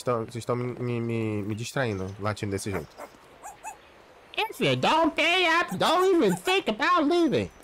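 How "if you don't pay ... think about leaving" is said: angry driver. Acted. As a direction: threaten him in a high strained voice. Angry driver demands payment.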